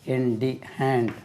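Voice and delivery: ordinary talk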